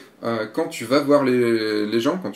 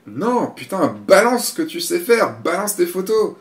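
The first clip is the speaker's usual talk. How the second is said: exasperated urging; 6 semitones higher, 4 dB louder, slower than usual. Acted. Urging the photographer to show photos.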